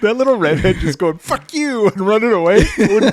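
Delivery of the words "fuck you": put-on high, squeaky kid voice; bratty kid. Redhead kid going.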